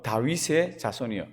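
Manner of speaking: ordinary talk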